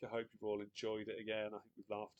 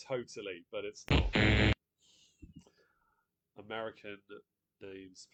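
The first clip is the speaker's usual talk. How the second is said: normal talking voice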